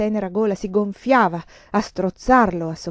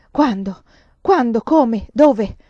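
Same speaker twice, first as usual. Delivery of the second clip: louder rapid-fire urgent questions; panting urgency; 2 semitones higher, 7 dB louder, slower than usual. A narrator's reading. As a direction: fire each question with rising frenzy. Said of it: "ansai io, posseduta da un demone". (ansimando, incalzante e frenetica)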